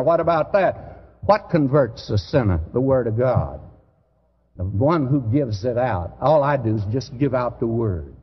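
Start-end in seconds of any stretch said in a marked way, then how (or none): none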